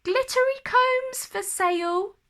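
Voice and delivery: put-on disguised queen calling out as a young girl. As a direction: call out like a young street seller. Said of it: "heard a young girl call out".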